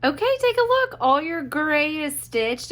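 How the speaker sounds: excited reveal. Real, not acted. Inviting viewers to see the gray stitching, excited. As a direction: lift the voice to unveil it.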